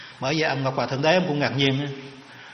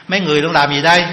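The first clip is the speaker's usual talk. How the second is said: mock questioning call; 8 dB louder, faster than usual. Brahmin questions the mourners.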